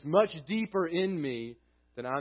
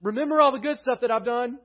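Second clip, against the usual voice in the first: louder, higher pleading list of excuses; a hint of a self-justifying sinner pleading louder; pleading bargainer; 8 semitones higher, 6 dB louder than usual. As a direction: bargain with God, excuse after excuse. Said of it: "David does not come before God saying".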